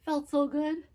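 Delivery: real laugh bubbling into the line. Real, not acted. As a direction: giggle out the words, delighted. Laughing about finishing a project.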